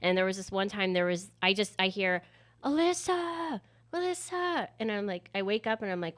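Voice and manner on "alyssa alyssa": calling out. Retold or imitated. Friends calling her from outside.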